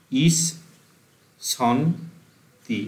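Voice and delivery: ইস, সন, তি each set apart by pauses; syllable by syllable. Shown as a demonstration. Teacher dictating ইস সন তি syllables for students to write. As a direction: split ইস সন তি into separate syllables. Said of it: "এটা লিখে রাখ".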